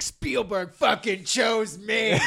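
slow shouted cocky boast; Colin Trevorrow impression. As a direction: drag out a bragging yell. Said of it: "my, uh, Colin Trevorrow impression".

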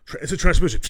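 hammed-up Goku quickly announcing a transmission; lightly voiced cartoon fighter; Goku impression. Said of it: "or like Goku".

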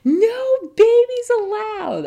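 put-on high, slow, drawn-out kid yell; defiant kid. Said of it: "and he goes".

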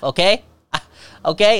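normal talking voice

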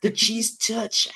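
mock spooky cheese touch chant; goofy high voice. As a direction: stretch it out in a high cartoon pitch. Echoing cheese touch as a joke.

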